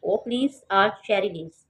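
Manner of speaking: slow textbook dialogue reading; slow careful reading. Shown as a demonstration. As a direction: read the ladybird's question aloud for the class. Reads ladybird's line.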